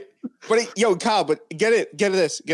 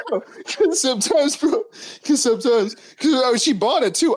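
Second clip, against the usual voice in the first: laughing; 8 semitones higher than usual. Real, not acted. Laughing hard at own joke.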